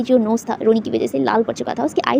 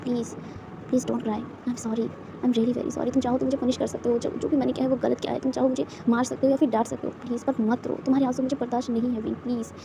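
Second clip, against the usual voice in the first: soft pleading apology; 8 dB quieter than usual. A narrator's reading. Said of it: "वो कहता है".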